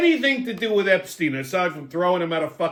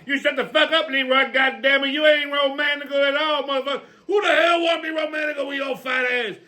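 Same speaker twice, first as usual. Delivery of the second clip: Big Mama character; 9 semitones higher than usual. Acted. Big Mama teasing Leroy about the news, high brassy voice. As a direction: cackle and tease like a brassy old auntie.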